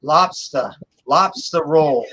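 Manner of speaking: "lobster" ending in an open "ah"; Boston-style "lobster", said lob-stah; dropped final R. Shown as a demonstration. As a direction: say "lobster" with no R at the end. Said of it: "Yeah, A H".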